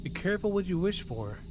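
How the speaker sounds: usual speaking voice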